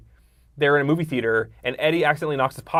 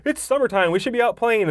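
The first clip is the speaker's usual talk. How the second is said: whiny Richie plea; 9 semitones higher than usual. Retold or imitated. Richie nagging the group to go play, high whiny kid voice. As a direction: whine it up high like a restless kid. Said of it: "Where Richie says many times".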